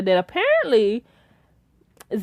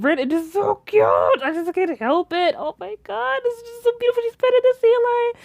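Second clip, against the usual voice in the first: gushing; 11 semitones higher than usual. Real, not acted. Gushing over the cute sea lion.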